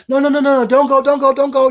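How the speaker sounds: mock pleading "don't go"; imitated pleading. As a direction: beg him not to leave, hammed up. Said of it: "Y'all remember her telling me".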